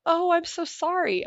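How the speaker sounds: high, slow, drawn-out pity; a hammed-up sorry, sung high and slow; mock pity. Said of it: "I had everybody go".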